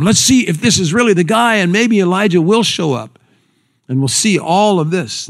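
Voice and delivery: high, lively crowd voice; acted onlookers. Voices sceptical onlookers awaiting Elijah.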